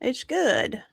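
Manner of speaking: usual speaking voice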